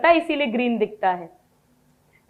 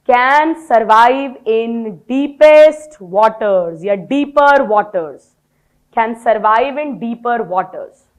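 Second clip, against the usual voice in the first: word by word dictation, very slow and louder; 2 semitones lower, 5 dB louder, much slower than usual. Shown as a demonstration. Teacher dictating biology notes to her class, very slow. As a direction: read it out slowly so they can write.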